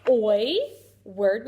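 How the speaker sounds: ordinary talk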